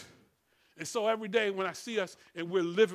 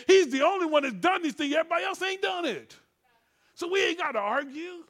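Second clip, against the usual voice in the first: raised, emphatic scripture preaching; full-force preaching; 6 semitones higher, 5 dB louder than usual. Real, not acted. Preacher charging the congregation to fight, full-force preaching. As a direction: drive the verses home, pitched up and loud.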